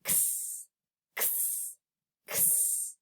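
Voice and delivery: whispered kss like knitting needles clicking; unvoiced kss, needles tapping together. Shown as a demonstration. Teacher shows the needle sound to kids, whispered kss. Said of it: "Can you do that?".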